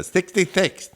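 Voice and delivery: Sixty-six said as Thixty-thix, s turned to th; lisping. Retold or imitated. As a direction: lisp both s sounds in Sixty-six. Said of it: "I was lisping on sixes".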